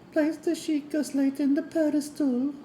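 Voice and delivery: high, sweet princess voice; a lilting fairy tale princess; Princess Zelda voice. Pretend. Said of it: "that's my Princess Zelda voice".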